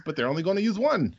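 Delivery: ordinary talk, nothing marked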